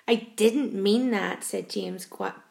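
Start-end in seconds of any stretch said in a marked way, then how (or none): none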